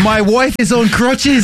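high, slow British imitation; hammed-up British tourist; mock British accent. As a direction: plead slowly in a put-on British accent. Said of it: "old guy with a British accent".

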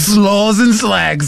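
very slow, high mock British; hammed-up British echo; British accent. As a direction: stretch each word in fake English. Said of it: "old guy with a British accent".

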